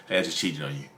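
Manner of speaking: low, soft, matter-of-fact mock confession; straight-faced. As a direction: deliver the confession deadpan. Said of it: "with a straight face".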